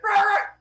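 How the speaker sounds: high, loud cheering cry; excited whoop. Acted. Phaethon shoots into the sky, whooping with excitement. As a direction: let out a wild joyful holler. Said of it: "he's super excited".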